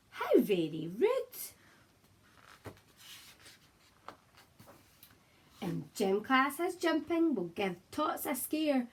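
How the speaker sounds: lively storybook read-aloud in rhyme; reading to children. Acted. Teacher reading a picture book aloud to her class, lively and gentle. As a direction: read it out like bedtime for little ones.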